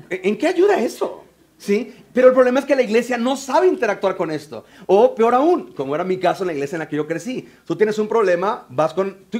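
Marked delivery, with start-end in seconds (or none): none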